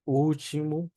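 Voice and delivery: último as "UU-chi-mu" with a long u; Portuguese pronunciation demo. Shown as a demonstration. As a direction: drop the l of último, lengthen the u. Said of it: "como una U larga".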